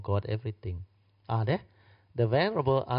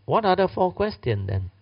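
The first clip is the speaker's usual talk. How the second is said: high, louder asking; eager question; 9 semitones higher, 5 dB louder than usual. Acted. Ananda eagerly asks Anuruddha, high and loud. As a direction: leap up with sudden interest. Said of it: "Anuruddha replied".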